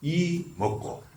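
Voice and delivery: "이" held long and high, then "먹고" dropped softly; terse hwadu demo. Shown as a demonstration. Monk demonstrates the concise Gyeongsang hwadu, held "이", short "먹고". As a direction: set "이" apart, then a short "먹고". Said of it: "이럴 땐 간단 명료해야 돼".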